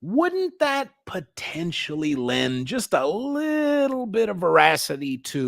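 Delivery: high, slow, teasing sarcasm; sarcastic drawn-out question. Real, not acted. To chat about Satanists, slow mock-innocent sarcasm.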